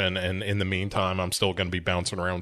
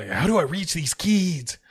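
slow, slightly higher earnest teacher question; mock earnest teacher; 6 semitones higher than usual. Mocking the movie teacher who wants to reach kids, slow and earnest. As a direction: ham up the inspiring teacher, slowly.

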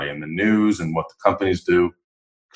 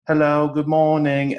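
slow, higher mock British greeting; British accent; 8 semitones higher, slower than usual. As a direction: imitate a posh British caller, slow and lifted. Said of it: "just to hear the British accent".

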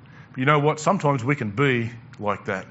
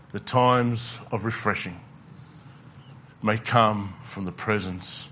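slow tender reading; 3 semitones lower, 5 dB quieter, slower than usual. Acted. Slow soft close of verse.

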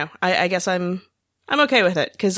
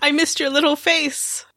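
Helena; 8 semitones higher than usual. Retold or imitated. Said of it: "where Helena says hello to Kira".

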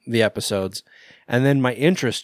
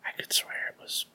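hushed whisper, no voice; 12 dB quieter than usual. Real, not acted. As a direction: whisper it so no one hears. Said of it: "Why are we whispering?".

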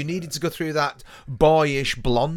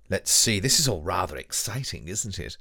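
ordinary talk, nothing marked

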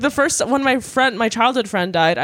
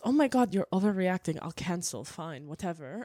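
grumbling quieter and quieter into nothing; dismissive fading mutter; 3 semitones lower, 12 dB quieter than usual. Retold or imitated. Mocking mom cancelling her plans, trailing off softer. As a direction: let it die away grudgingly. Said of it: "And she goes".

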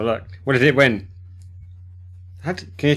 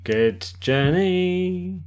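drawn out; 5 semitones higher, 3 dB quieter, much slower than usual. Real, not acted. Group sign-off catchphrase.

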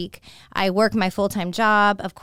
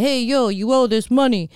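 put-on tough heavy New York accent, punchy and loud; gruff mock New York tough guy; New York mafia accent; 3 semitones higher, 5 dB louder than usual. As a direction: imitate a New York debt collector leaning on you. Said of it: "he had this very heavy New York accent".

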